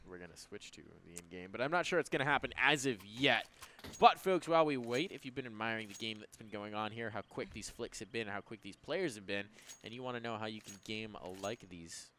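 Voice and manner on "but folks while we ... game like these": sponsor ad read. Real, not acted.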